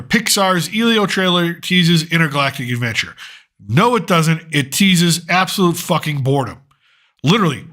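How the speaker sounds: ordinary talk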